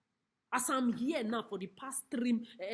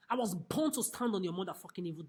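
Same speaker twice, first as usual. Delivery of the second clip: usual speaking voice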